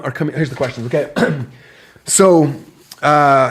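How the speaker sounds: ordinary talk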